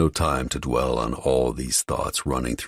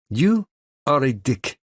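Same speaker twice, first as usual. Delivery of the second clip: put-on thick Russian accent, higher and louder; a playful jab in a thick Russian accent; 11 semitones higher, 4 dB louder than usual. A narrator's reading. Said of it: "in her thick Russian accent".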